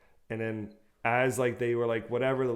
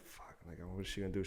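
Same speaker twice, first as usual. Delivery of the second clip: hushed, breathless worry to oneself; soft worried whisper; whispered dread; 4 semitones lower, 13 dB quieter than usual. Retold or imitated. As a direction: whisper the fear under your breath. Inner dread in the car.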